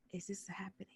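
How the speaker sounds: very soft, disbelieving; hushed, barely voiced disbelief; stunned inner thought. To herself at the rally, a hushed stunned question. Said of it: "I'm like".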